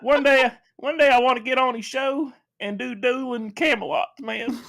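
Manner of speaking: high drawling southern dude; southern guy impression.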